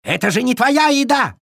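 high-pitched accusing cry; indignant scolding. A narrator's reading. Friends accuse the food thief, scolding indignantly. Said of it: "накинулись на обжору все разом".

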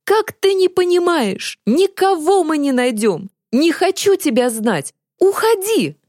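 high-pitched, furious outburst; angry yelling. A narrator's reading. Boy driving the girl away, angry yelling. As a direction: cry out in exasperation, pitch soaring. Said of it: "завопил Захар".

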